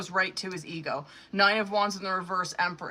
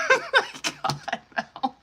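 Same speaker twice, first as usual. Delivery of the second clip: high squealing laugh into oh my God; laughing burst; slower than usual. Real, not acted. Reader cracking up at the cards for Mel, high squealing laugh. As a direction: crack up in disbelief, pitch way up.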